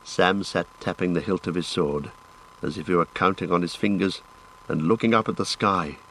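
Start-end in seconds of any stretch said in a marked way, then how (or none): none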